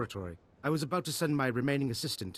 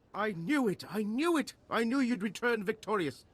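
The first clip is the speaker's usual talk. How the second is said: high-pitched, jubilant exclamations; triumphant delight; 8 semitones higher than usual. Acted. Scientist welcoming back the victorious hero, jubilant. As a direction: crow with delight, pitch leaping up.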